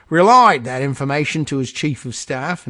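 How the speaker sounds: mock Aussie accent that lands nearer Cockney; Australian slipping into Cockney. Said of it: "that sounded more Cockney than anything else".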